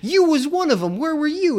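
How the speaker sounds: high, sing-song mock interrogation; exaggerated accusatory taunt; mock accusing bully. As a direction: push the pitch up and pounce on him. Acting out guys accusing Eric.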